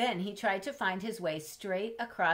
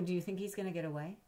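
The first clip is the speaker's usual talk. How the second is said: plain reading voice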